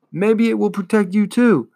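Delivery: high, pleading cry. A narrator's reading. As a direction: call out in desperate hope. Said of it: "Atreyu cried".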